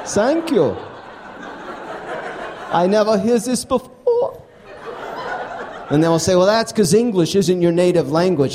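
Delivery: high, lively stage delivery for a laughing crowd; stand-up comedy set. Acted. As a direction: sell each punchline to the room. Stand-up set before interview.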